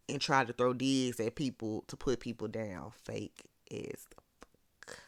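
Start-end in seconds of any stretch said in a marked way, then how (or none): 3.0-5.0 slow whispered dig; under-the-breath, drawn-out whisper; whispered aside. Real, not acted. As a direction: drop to a whisper for the insult.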